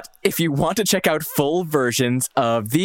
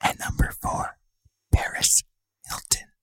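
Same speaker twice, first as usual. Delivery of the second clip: ASMR whisper. Shown as a demonstration. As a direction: whisper it close to the mic. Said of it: "to emulate them".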